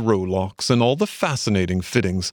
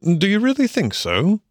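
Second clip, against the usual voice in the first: higher, earnest question; sincere, slightly puzzled Rat; serious; 11 semitones higher than usual. A narrator's reading. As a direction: ask in mild seriousness, pitch lifted. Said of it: "inquired the rat seriously".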